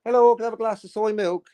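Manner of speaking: high put-on caller voice; pretend caller. Host faking a caller asking for soy milk. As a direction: play a silly listener calling in.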